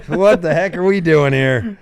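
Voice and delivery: high, loud laughing question. Real, not acted. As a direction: laugh through the line. Laughing at their own bit.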